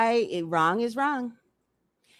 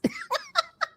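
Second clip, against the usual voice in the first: high squealing laugh; 4 dB quieter than usual. Real, not acted. Laughs at own breathy voice.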